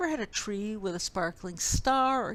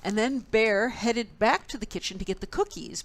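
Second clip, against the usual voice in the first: usual speaking voice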